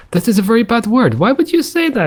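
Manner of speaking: high-pitched prim scolding, played up; mock shocked. Mock shocked reply to swearing shark.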